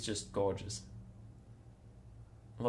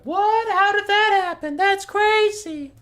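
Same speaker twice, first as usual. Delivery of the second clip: high squeaky shocked voice; exaggerated shocked fan; mock disbelief; 10 dB louder, slower than usual. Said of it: "everyone's like".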